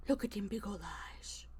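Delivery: gasp, then whisper in awe. Real, not acted. Cooing at cat.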